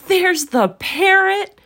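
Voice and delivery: very high, loud, drawn-out shout; excited cry. A narrator's reading. Scrooge spots the parrot, crying out in delight. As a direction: cry it out slowly at the top of the voice. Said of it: "cried Scrooge".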